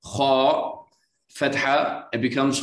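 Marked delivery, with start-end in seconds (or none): none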